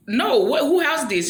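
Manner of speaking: high, loud incredulous demand; outraged husband. Retold or imitated. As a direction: demand answers in loud disbelief. Husband shocked at run-down house.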